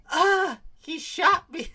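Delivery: high, loud, drawn-out fake moan; mock moan. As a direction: moan it in pretend pain, badly. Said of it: "I can't do a fucking good moan".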